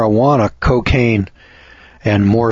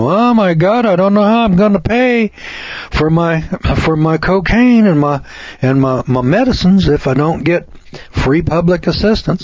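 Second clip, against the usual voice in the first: high, pleading mock-elderly voice, pitched well above his own; mock whiny old person; 6 semitones higher than usual. Radio host mocking elderly people who cry about drug costs, whiny high mock voice. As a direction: hams up an old person begging over pill bills.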